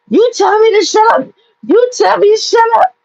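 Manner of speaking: high, sing-song mimicry of her complaint; mocking whiny friend. Mimicking the friend at the elevator in a high mock whine. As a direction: parrot her outrage to make it sound silly.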